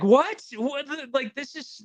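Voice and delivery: high, stunned "What? What the"; shocked disbelief. Retold or imitated. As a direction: pitch it up in open-mouthed shock. Shocked at the promoter.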